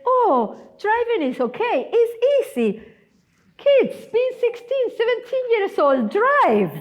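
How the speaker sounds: high, singsong "it's so easy" mimicry; lightly mocking, high-pitched dismissal; breezy reassurance. Acts out everyone dismissing driving as easy.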